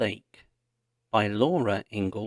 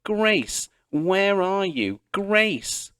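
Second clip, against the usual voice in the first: high, slow shouted calls; distant shouts; 9 semitones higher, much slower than usual. A narrator's reading. Pa and Ma shouting for Grace from the slough. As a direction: call far across the slough. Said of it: "Pa's shouts".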